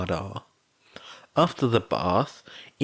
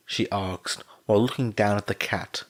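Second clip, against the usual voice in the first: ordinary delivery, nothing marked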